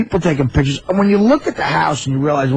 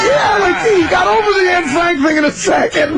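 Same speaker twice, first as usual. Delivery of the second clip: laughing; 13 semitones higher than usual. Real, not acted. Laughing at the pancake story.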